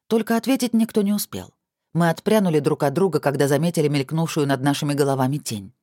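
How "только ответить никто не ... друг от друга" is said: ordinary delivery, nothing marked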